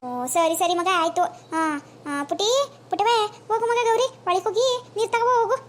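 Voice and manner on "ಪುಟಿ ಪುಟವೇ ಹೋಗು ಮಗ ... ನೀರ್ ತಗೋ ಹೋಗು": put-on higher, called out to a child indoors; calling to the girl. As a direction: sing out to the girl to go fetch water. Grandma calls girl inside.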